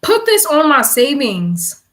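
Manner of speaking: slow, loud order to the phone; loud command. Real, not acted. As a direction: spell it out for the voice assistant. Talking to phone voice assistant.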